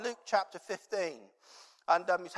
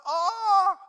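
frustrated cry; 14 semitones higher, 10 dB louder than usual. Retold or imitated. Boy fumbling with frozen hands.

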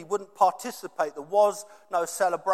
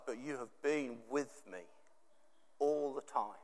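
much softer, lower and tender; gentle father; 6 semitones lower, 13 dB quieter than usual. Retold or imitated. Father gently answers complaining son, very soft. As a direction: hush him with love. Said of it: "he's just like".

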